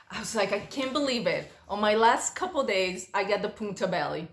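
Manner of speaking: ordinary talk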